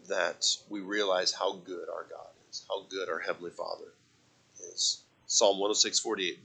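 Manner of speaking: ordinary delivery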